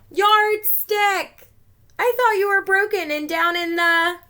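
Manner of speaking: high, loud joyful shout; excited cry. Acted. Scissors overjoyed to see Yardstick again. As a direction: cry out in delighted surprise.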